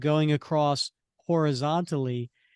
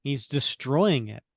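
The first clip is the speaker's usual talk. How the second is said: ordinary talk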